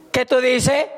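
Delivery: indignant retort. Retold or imitated. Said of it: "Rápido cambia el tono de voz".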